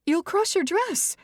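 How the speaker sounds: very high, loud, slow scolding; shrill-pitched society mother; fussy matriarch. Acted. Mrs Evers scolds.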